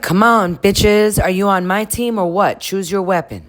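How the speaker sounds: mock-tough call to arms; imitating Sierra. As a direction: bark it like rallying troops. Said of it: "And Sierra's like".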